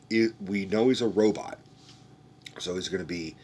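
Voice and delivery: normal talking voice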